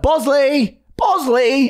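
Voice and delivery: high sing-song call; calling the dog. Retold or imitated. Owner calling the runaway dog, high call. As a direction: call him back across the park.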